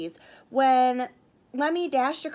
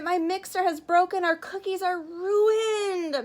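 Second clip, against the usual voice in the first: upset; 6 semitones higher, 4 dB louder than usual. Acted. Contestant upset, cookies ruined.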